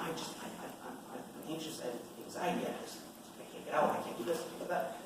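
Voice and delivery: breathy stammer of a worried voice, barely voiced; imitating anxious women. Mimicking women at work voicing anxiety, hushed and halting. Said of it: "I hear all the time".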